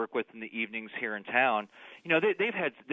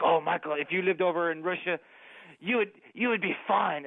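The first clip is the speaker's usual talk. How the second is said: lightly imitated Russian accent, pitched higher; mock a Russian colleague's amazed accent; 4 semitones higher than usual. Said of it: "she had this outrageous Russian accent".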